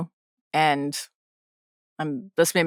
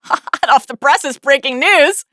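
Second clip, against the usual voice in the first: mock news hype; 9 semitones higher, 5 dB louder than usual.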